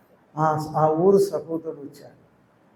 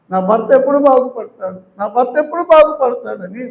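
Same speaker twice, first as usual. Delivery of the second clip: mock wife's lament; 6 semitones higher, 7 dB louder than usual. Wife's lament voiced, repeated louder.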